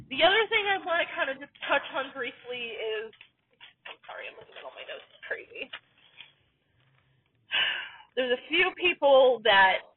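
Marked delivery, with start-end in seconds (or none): 3.9-5.7 fast, breathless whisper to herself; whispered aside. Real, not acted. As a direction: mutter it under your breath while reading notes. Aside while checking notes.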